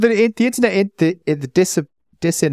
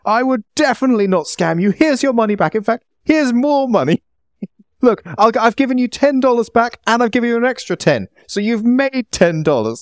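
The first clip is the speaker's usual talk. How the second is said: mock indignation; 7 semitones higher than usual. Mock outrage at scam accusation.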